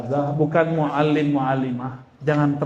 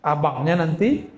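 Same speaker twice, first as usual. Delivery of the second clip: ordinary delivery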